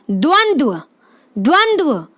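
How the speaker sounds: द्वंद्व said with a clear v after each d; sounding out the word. Shown as a demonstration. Teacher saying द्वंद्व for students to repeat. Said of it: "बोल के देखें".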